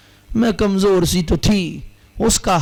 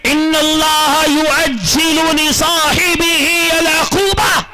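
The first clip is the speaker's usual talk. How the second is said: slow, high, chanted Arabic recitation; Arabic hadith drawn out in a high shouted chant; recited hadith; 9 semitones higher, much slower than usual. Acted. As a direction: intone the Arabic solemnly.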